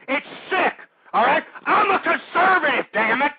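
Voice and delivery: the radio host shouting at his listeners that he's a conservative; furious shout. Real, not acted. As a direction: scream it, losing your temper.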